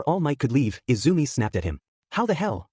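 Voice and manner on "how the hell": high-pitched exclamation at ordinary volume; understated yell of outrage; mild tearful yell. A narrator's reading. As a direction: raise the pitch, not the volume. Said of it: "she yelled, tears streaming".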